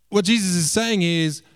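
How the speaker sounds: ordinary delivery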